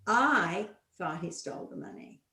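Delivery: "I" stressed at the start. Shown as a demonstration. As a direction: punch the word "I". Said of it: "give them sentences like".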